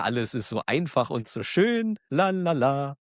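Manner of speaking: normal talking voice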